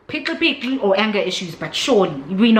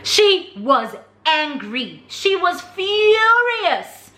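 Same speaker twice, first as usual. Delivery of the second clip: drawn-out, rising to a high furious; slow emphasis; 7 semitones higher, much slower than usual. Real, not acted. Describing the relative's fury, slow and building. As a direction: spell it out slowly. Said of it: "emphatic slow telling".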